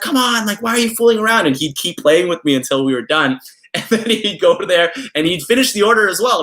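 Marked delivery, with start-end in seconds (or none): 0.0-1.5 high, mock-scolding grandma voice; a hammed-up impatient grandma calling out. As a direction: nag him to get back to work.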